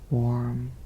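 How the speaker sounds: usual speaking voice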